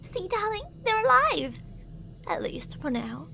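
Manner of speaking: a possessive captor's sweet, menacing voice; obsessive lover. Acted. Captor sweet-talks the darling they kidnapped, creepy and possessive. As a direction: croon to your darling while threatening the captive.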